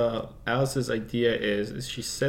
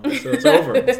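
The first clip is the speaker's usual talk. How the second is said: laughing; 14 semitones higher, 10 dB louder than usual. Real, not acted. Laughing at own joke.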